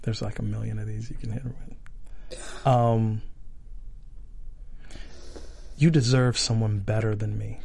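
lightly acted breakup, low and quiet; mock breakup scene. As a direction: play a soft, earnest breakup. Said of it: "it's a breakup scene".

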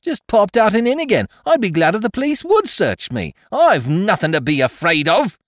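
high, loud self-righteous outburst; indignant outburst. A narrator's reading. Chambermaid daring the police to search her, indignant. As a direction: defy them to search you.

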